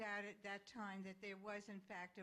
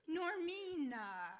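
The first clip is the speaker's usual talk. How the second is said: put-on slow, higher French-accented therapist; lightly put-on French accent, calm and questioning; hint of a French-accented shrink asking gently; 5 semitones higher, 6 dB louder, slower than usual. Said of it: "French accent".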